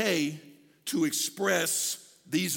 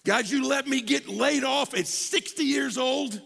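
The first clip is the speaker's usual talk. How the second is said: higher-pitched, drawn-out protest; aggrieved complaint; 6 semitones higher, 4 dB louder than usual. Acted. Laid-off worker complaining to God, aggrieved. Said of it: "we hear it like this".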